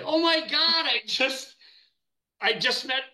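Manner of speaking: reeling from the star's brush-off, stunned; starstruck shock. Retold or imitated. After Captain Kirk glares at him, stunned high disbelief. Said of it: "And I, I was like".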